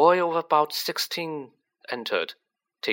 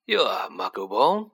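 put-on old headmaster voice. A narrator's reading.

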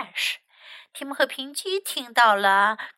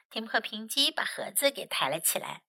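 usual speaking voice

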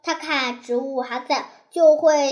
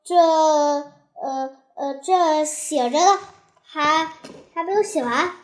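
halting, drawn-out stammer with fillers; hemming and hawing; 3 dB louder, slower than usual. Acted. Child caught out by mother's call, hemming and hawing. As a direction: stall and stumble over the words. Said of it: "他支支吾吾地说".

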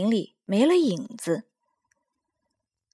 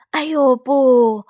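slow, loud anguished cry; drawn-out shout of despair; cry of dismay; 4 semitones higher, 8 dB louder, slower than usual. Acted. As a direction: wail as the path collapses. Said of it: "他叫道".

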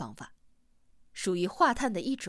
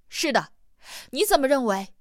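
acted raised voice, higher and louder; calling out; 4 semitones higher, 5 dB louder than usual. A narrator's reading. Huaite asks Hamsen over the waterfall, calling out. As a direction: ask loudly across the noise.

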